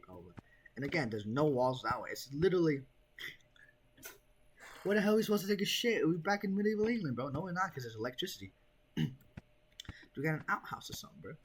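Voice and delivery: usual speaking voice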